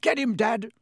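high-pitched, restrained yell. A narrator's reading. A son cheers his father on in a fight, yelling. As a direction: egg him on excitedly. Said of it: "There was a yell of".

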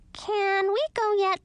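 high slow whiny plea; squeaky impatient bat. Acted. Lil impatient.

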